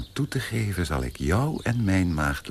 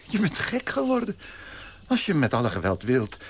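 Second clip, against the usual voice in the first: much higher, appalled cry; horrified; 8 semitones higher than usual. Acted. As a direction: shriek it in disgust. Fiancé horrified. (ontzet, hoog)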